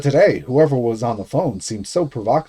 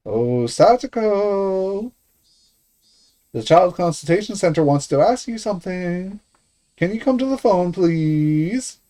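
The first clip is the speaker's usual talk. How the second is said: high, slow, sugary fake kindness; sweet phony voice; 6 semitones higher, slower than usual. Guardian calls his niece to the phone in sweet phony voice. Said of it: "addressing Satsuko in a sweet, phony voice".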